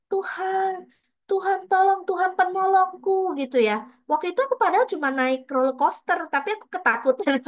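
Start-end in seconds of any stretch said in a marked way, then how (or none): 0.1-3.4 high, pleading cry for help, voice stretched thin; crying out to God. Retold or imitated. Reliving a moment of fear, begging God for help in a high cry. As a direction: call on God like you're suddenly in danger. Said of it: "Di situ itu aku bisa langsung".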